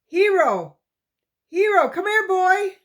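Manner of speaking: loud, high call to a distant dog; urgent high call, voice pushed out. A narrator's reading. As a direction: shout for the dog across the brush. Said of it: "sucked his breath to call out".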